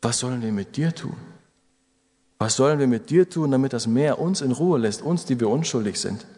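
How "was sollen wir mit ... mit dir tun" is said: normal talking voice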